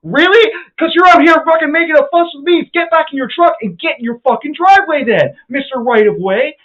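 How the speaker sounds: high, loud sarcastic yelling; mock him at the top of your voice; retold furious shouting. Taunting the driver in the road, high loud sarcastic shouting.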